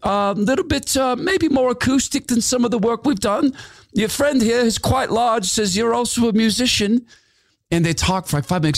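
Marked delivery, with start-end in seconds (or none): 0.0-7.1 high, chatty put-on voice; Glenn on the phone. Glenn chatting with Matthew about records, in a high put-on voice. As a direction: chat easily about the new record, pitched up. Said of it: "He goes".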